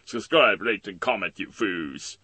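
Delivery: very loud supervillain order; villain command. Acted. Villain ordering viewers to rate and comment, loud and bullying. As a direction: boom it out like a cartoon tyrant.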